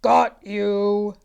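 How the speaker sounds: loud growl of a giant. Acted. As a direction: snarl it as the giant grabbing Jack. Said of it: "the giant growled".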